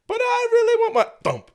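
put-on very high, loud kid's whine; mock whining child. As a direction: whine like a kid begging in a store. Imitating a kid begging in supermarket.

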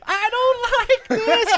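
high, slow frightened whine; scared girl voice. Retold or imitated. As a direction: whimper it in a high voice. Quoting Chrissy scene line.

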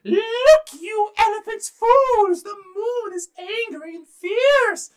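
very high, loud, slow rabbit warning; shrill little rabbit prophet, words stretched out. Acted. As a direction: squeal a dire threat like a tiny rabbit. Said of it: "And the rabbit said".

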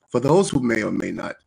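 low, far dramatic reading voice; deep dramatic ad read. Shown as a demonstration. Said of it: "listen to how I was gonna read it".

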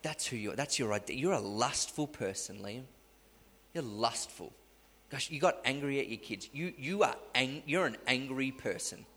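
hushed, needling accuser; the accusing devil on the phone to Liam, hushed; soft accusing voice. Retold or imitated. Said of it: "these little voices come".

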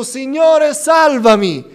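loud, high plea for rescue; preacher acting out a desperate cry to Jesus. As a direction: call out high and loud for help. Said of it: "gridò a Gesù".

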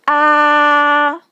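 "Ah" held high and level; first tone. Shown as a demonstration. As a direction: keep "Ah" high, long and perfectly even. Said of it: "I'm going to put a first tone".

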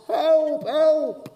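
high, drawn-out call for help; acted high-pitched yell; mock shout for help. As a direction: cry out for rescue at the door. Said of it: "he shouts".

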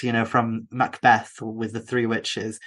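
ordinary talk, nothing marked

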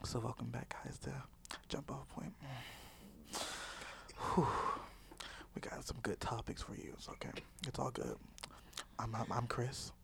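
hushed, low podcast intro in ASMR; soft mock-ASMR murmur; ASMR whisper. Shown as a demonstration. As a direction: whisper a welcome to the listeners. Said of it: "wants to hear the A S M R".

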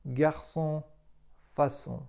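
"garçon" and "façon" with Ç as S and a nasal "on"; teacher showing cedilla C as S; French pronunciation. Shown as a demonstration. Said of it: "tak jak w słowach".

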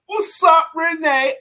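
excited hello to Renee; excited shout-out. Real, not acted. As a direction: call out to a friend you spot. Calling out to a listener.

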